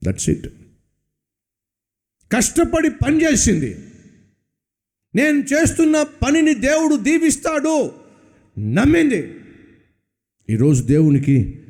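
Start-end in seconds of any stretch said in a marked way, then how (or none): 2.3-9.3 high ringing proclamation; preaching at full force. Acted. Preacher to congregation about Ruth, impassioned. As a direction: proclaim her faith.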